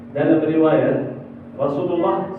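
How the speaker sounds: ordinary delivery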